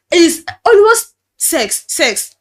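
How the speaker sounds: angry accusation. Retold or imitated. Pastor accusing her.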